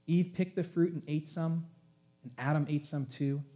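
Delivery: ordinary talk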